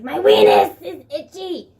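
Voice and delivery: loud, high, drawn-out goofy yell; silly outburst. Acted. Breaking off the dad story, silly loud outburst. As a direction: blurt it out absurdly big.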